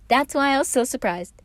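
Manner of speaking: high, loud laughing line; laughing Patton. A narrator's reading. Patton surprised at Virgil, laughing high and loud. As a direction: laugh right through it.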